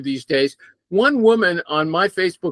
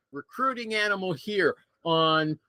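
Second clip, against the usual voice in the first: ordinary delivery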